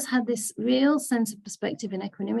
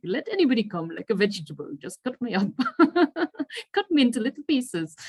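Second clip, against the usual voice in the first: lightly imitated Indian accent, quick; quick amused Indian accent impression; 5 semitones higher, 3 dB louder, faster than usual. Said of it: "he always sounds very charming with his Indian accent".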